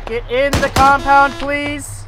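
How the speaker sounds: frustrated yelling. Real, not acted. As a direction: yell it at the blocking trucks. Frustrated driver yelling at convoy.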